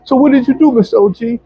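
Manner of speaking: a hint of a curious child; imitating a kid. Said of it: "this one little kid... he was like".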